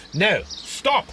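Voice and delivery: sharp, rising cry to stop her; urgent shout. Acted. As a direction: call out in alarm. Holmes sees her about to jump.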